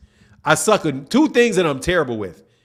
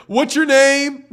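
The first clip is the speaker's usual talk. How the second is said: put-on higher, slow teasing kid voice; mocking schoolkid; 8 semitones higher, slower than usual. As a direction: play the kid setting up the fart joke. Kids teasing him about Martin.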